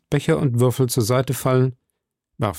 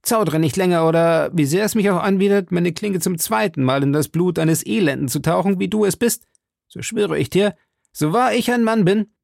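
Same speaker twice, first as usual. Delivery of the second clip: put-on young D'Artagnan swearing; 7 semitones higher than usual. A narrator's reading.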